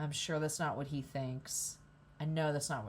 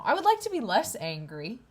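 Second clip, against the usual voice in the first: frustrated outburst; 6 semitones higher, 8 dB louder than usual. Real, not acted. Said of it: "I'm really angry now".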